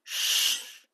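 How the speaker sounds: long hissed shush; hushing shush. Acted. Sister hushing sister so parents won't hear, hushing. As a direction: shush someone to keep them quiet.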